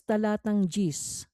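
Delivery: usual speaking voice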